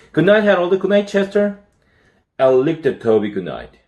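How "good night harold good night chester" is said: put-on high, bright child's goodnight; cheerful goodnight. A narrator's reading. A boy calls goodnight to the pets, high and cheerful. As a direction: call it out happily.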